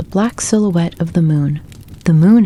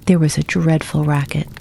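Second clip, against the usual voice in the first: plain reading voice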